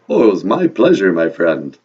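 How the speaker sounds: put-on loud Transylvanian vampire accent, falling low; Count. Count answers the host's thanks, vampire accent. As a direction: sign off grandly in a vampire accent. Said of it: "your vampire accent".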